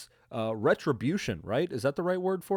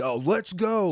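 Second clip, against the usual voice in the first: high-pitched hype cheer; quick mock-stoked exclamation; stoked dude; 8 semitones higher, slower than usual. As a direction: imitate a pumped-up bro. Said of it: "There's a lot of Stoke dudes".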